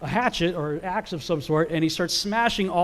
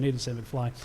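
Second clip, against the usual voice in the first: soft, lower aside; plain aside; 5 semitones lower, 8 dB quieter than usual. Real, not acted. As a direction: drop it under your breath as a correction. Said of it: "that's my elaboration".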